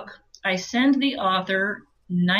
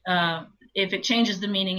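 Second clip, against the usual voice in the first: usual speaking voice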